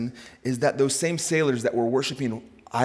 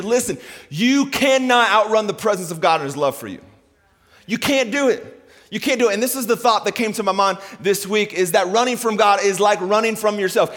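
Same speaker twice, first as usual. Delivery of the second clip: raised, insistent sermon delivery; full-force preaching; 7 semitones higher, 5 dB louder than usual. Real, not acted. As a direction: hammer the promise home to the congregation.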